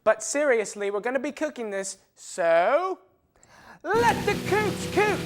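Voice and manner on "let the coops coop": high squeaky chant, loud; high silly catchphrase. Acted.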